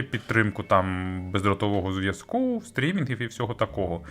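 ordinary talk

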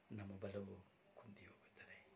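hushed whisper to the congregation. Real, not acted. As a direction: lean in and whisper it. Preacher drops to a whisper. (ಪಿಸುಮಾತಿನಲ್ಲಿ)

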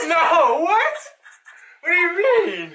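high laughing protest; laughing disbelief. Real, not acted. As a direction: laugh out the shock. Wrong guess, laughing.

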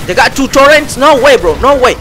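high, loud "no way" burst; shocked disbelief. Real, not acted. Gamer shocked to find two turrets ahead, yelps in disbelief. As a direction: blurt it out in real shock.

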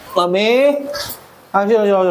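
high coaxing call. Real, not acted. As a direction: coax the little one to come. Going to fetch the baby. (memanggil dengan nada tinggi)

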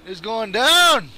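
slow, building yell; drawn-out battle cry. Real, not acted. Gamer vowing to take down his target, drawn-out yell. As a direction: stretch the last word into a yell.

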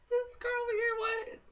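hammed-up incredulous squeal; disbelief. Zach's disbelief, retold.

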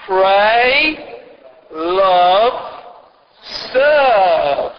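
each word loud and drawn right out; long, stretched words with pauses between; very slow. Shown as a demonstration. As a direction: lead a group through it slowly. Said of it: "if we say them slowly".

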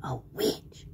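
low, hushed spooky witch; witch whisper. A narrator's reading. Tweety Witch announcing herself to Sylvester, spooky whisper. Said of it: "said the Tweety Witch".

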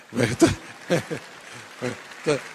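helpless laughter between broken words; real laughing. Real, not acted. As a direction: try to go on but keep breaking up. Breaks up at his own joke. (muerto de risa, sin poder seguir)

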